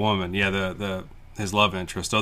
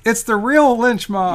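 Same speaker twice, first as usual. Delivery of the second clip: loud, drawn-out falsetto imitation; high-pitched voice; 13 semitones higher, 6 dB louder, slower than usual. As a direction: squeal it out high, mimicking him. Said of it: "in such a high-pitched voice".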